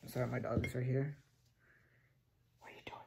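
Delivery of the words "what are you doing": whisper. Real, not acted. Whispering to dog at night.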